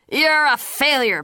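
slow, high, loud contempt; harsh scolding. A narrator's reading. Father telling Pacey he's a failure, slow loud harsh scolding. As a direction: hit each word hard and slow.

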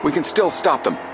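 high, urgent rallying call; exclaiming. A narrator's reading. Frank to Joe at the fence, exclaiming. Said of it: "Frank exclaimed".

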